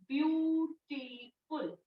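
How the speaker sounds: Beautiful slow and soft as view, टी, फुल; drawn-out beautiful with a gap after each syllable. Shown as a demonstration. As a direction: stretch beautiful into three parted beats. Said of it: "कैसे बन रहा है ये".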